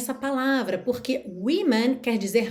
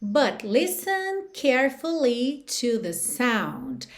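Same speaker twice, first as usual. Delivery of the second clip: slow demo; slower than usual. Shown as a demonstration. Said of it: "teacher reads song line slowly".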